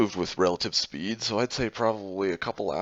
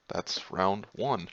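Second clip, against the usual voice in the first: ordinary talk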